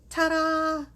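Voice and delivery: high, loud, drawn-out ta-da; triumphant ta-da. Real, not acted. Crafter showing viewers the glued piece, triumphant ta-da. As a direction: sing it out as a reveal.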